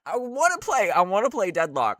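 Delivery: high, loud, fast eager whine; excited whine. Real, not acted. Excited whining about game.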